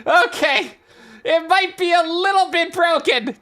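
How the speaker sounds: ordinary delivery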